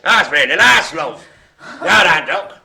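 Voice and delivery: loud mock Mancunian, like an old soap; sixties Mancunian accent. Host teases [name]'s accent attempt, mock sixties Mancunian accent. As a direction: parody a Coronation Street Mancunian accent. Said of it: "That sounded more nineteen sixties Mancunian".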